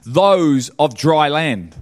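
high, loud, impassioned sermon delivery; preaching at full force. Acted. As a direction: drive it home like a revival preacher, slow on key words.

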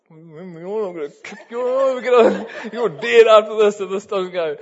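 loud, high, laughing mock threat. To the teasing kids before the drop, a loud laughing mock threat. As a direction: shout a joking threat at the kids. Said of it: "And I'm like".

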